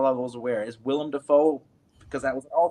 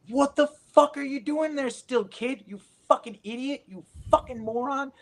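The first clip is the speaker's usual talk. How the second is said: high, strained berating voice, much higher; retold furious scolding, pitched way up; imitating an angry yell; 12 semitones higher than usual. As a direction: chew him out like a frantic mentor. Angry mentor yelling at kid.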